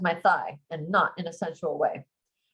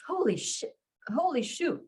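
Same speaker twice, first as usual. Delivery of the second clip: shocked; 8 semitones higher, 4 dB quieter than usual. Acted. Shocked at her racing heart.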